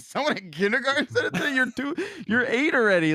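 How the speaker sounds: mock outrage at the kid's excuse; fast, high, mock-shocked dad; retold disbelief. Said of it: "I said".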